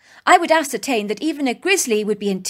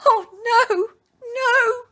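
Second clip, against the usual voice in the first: much higher, sobbing cry; 14 semitones higher, much slower than usual. Acted. Helpless woman sobs beside her stuck car, crying. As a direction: break down in tears on it. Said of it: "I began to sob and sob".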